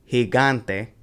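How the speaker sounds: gigante as gi-GAN-te; stress on second syllable. Shown as a demonstration. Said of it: "you put the stress on the second one".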